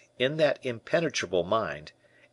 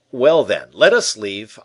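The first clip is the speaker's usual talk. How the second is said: much louder, decisive announcement; aloud; 11 dB louder than usual. A narrator's reading. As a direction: raise the voice for all to hear. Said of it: "Then aloud".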